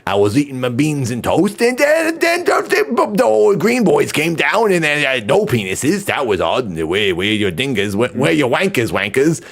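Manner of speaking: put-on over-the-top Welsh accent, high and drawn-out; badly faked Welsh yokel telling a tall tale; hammed-up Welsh, swooping high then dropping; mock Welsh accent. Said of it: "Do a Welsh accent".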